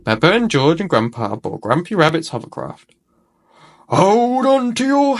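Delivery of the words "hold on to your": high, slow, drawn-out hollering; a rabbit calling to passengers as they zoom off; excited shout. A narrator's reading. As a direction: stretch it like a thrill-ride warning. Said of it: "shouts Grumpy Rabbit".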